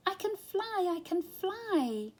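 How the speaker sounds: high delighted cry; little elephant crying out happily, high; joyful cry. A narrator's reading. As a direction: cry out in sheer joy. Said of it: "cried Dumbo".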